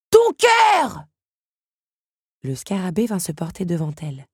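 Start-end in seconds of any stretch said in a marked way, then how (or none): 0.1-1.1 high, very loud urgent yell; insistent shout. Acted. Scarab insists to Belle, shouting urgently. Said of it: "insista Lucanos".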